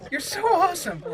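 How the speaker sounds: ordinary delivery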